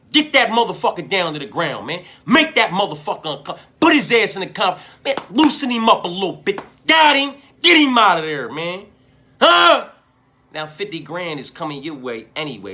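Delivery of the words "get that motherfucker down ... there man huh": higher and louder, urgent hype at the fighter; real heated pep talk, voice climbing; worked-up coaching rant. Real, not acted. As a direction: fire him up like a cornerman yelling advice. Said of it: "I got worked up over that one".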